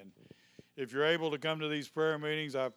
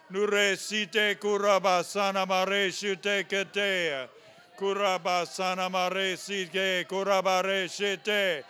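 fervent rolling tongues, louder; speaking in tongues; 3 semitones higher, 8 dB louder, slower than usual. Real, not acted. Pastor praying in tongues over the congregation, fervent. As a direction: pour out tongues in worship.

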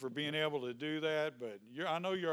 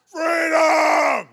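huge high yell of freedom; full-throated shout; 12 semitones higher, 17 dB louder than usual. Real, not acted. Pastor leading the congregation's shout, full-throated yell. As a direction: cry it out with everything.

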